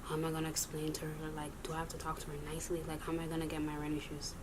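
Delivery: low-key, hushed muttering to yourself; soft, rapid acted thinking aloud; worried inner thoughts. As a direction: fret under your breath, soft and quick. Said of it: "I'm thinking, I'm like".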